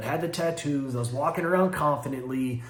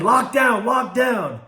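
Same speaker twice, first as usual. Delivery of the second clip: retold guard yelling; 7 semitones higher, 4 dB louder, slower than usual. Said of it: "the guard starts yelling".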